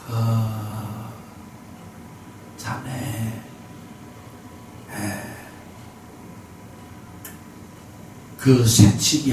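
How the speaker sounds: put-on very old man gently rebuking the shouter, slow stammering. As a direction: hesitate on every word like a frail ninety-year-old. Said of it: "말을 더듬더듬 하시면서".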